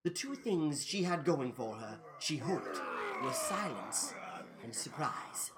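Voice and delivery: low, soft narration fading to near whisper; hushed suspense. A narrator's reading. Narrator as Allison creeps toward the ghouls, hushed. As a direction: drop your voice as if sneaking.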